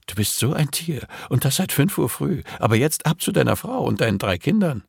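high, put-on female voice; woman's voice. Dubbing the woman sending her lover home, high female voice. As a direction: playfully scold in a high woman's voice.

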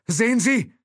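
high, loud frantic exclamation; panicked cry. Acted. Driver letting go of the wheel, shouting in panic. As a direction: yelp it in panic, pitched right up. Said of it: "Panik in der Stimme".

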